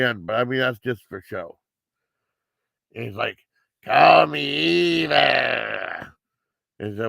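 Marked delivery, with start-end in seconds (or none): 3.8-6.1 slow, disguised voice-changer voice. Retold or imitated. Killer calls his victim, speaking in a slow voice-changer voice. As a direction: stretch each word out like a distorted phone killer. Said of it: "this voice changer".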